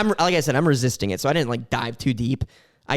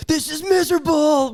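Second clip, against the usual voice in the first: whiny complaint; 11 semitones higher, 4 dB louder than usual. Pretend. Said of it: "they're like".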